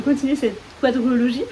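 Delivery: normal talking voice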